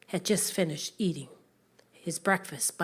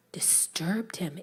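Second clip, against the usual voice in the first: plain reading voice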